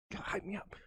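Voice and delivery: breathy urgent whisper, no voice at all. Real, not acted. Pleading with Paul in a sudden whisper. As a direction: hiss it under the breath.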